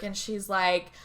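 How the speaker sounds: ordinary delivery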